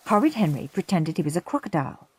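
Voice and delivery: ordinary delivery, nothing marked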